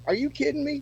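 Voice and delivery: high-pitched, retold disbelief; incredulous friend. Friend reacting to a first solo show, incredulous.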